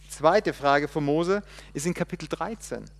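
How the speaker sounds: ordinary talk, nothing marked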